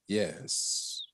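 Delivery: mock deep, hushed bass. Host imitating the man's entrance, deep bass voice. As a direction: drop low and soft, imitating a deep-voiced man. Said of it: "He got a deep ass voice".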